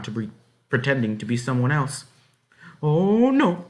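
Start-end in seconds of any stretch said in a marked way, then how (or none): 2.8-3.7 high, loud alarmed cry; cry of dismay. A narrator's reading. Girl in disguise panics at being told to undress, dismayed cry. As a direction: blurt it out in dismay. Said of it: "she cried in dismay".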